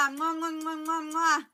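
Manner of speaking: high smacking blown kisses; between kisses. Real, not acted. Blowing kisses to a viewer.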